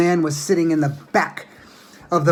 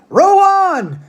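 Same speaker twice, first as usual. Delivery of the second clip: much higher, louder shout; Mister Wonka shouting to the rowers; 14 semitones higher, 9 dB louder than usual. A narrator's reading. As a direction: bellow the order to the rowers. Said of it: "shouted Mister Wonka".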